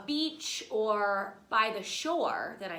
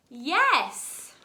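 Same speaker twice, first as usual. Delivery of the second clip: a louder yes for the class to echo; answering yes with the class; 3 semitones higher, 7 dB louder than usual. Shown as a demonstration. Teacher leading children to chime in, louder yes. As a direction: call it out for kids to repeat. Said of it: "say it with me".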